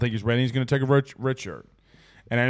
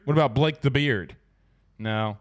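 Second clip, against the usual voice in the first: ordinary delivery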